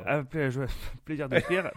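laughing through the words. Real, not acted. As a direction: chuckle while saying it. Jokey thanks, laughing.

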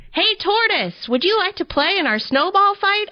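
high, quick squirrel voice; eager squirrel. A narrator's reading. Squirrel inviting tortoise to a snowball fight, high and quick. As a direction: chatter it fast and high. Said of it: "asked Squirrel".

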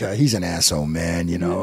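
low, dismissive New York bartender. Acted. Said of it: "classic New York bartender".

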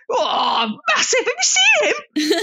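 hammed-up shout across the queue to Chaz; imitating a brash bloke. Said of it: "he was talking like that".

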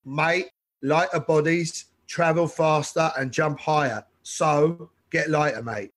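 put-on slow, London Archway accent, like a blunt trainer; slow North London Archway accent, coach giving orders. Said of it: "he's from Archway. So this is the last accent I'll try".

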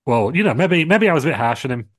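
mock sheepish backtrack. Triple H admits to Hogan he was harsh, hammed-up sheepish. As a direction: act like he's caving in, all put on.

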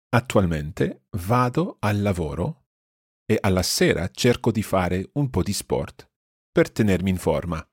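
slow, careful Italian for learners; teacher's slow model reading; slow repeat. Shown as a demonstration. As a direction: read it slowly, pausing between phrases. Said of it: "I'll repeat this more slowly".

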